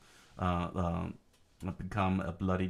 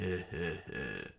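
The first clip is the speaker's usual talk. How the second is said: low, short put-on chuckle; acted laugh; 4 semitones lower, 6 dB quieter than usual. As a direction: laugh it out in character. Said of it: "uh, laughed".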